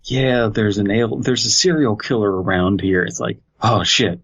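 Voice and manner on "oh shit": ordinary talk, nothing marked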